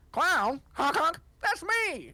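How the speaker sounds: high, slow goofy clown voice; silly clown. Acted. Clown Merrywick introducing himself to the bard. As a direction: honk it out like a clown.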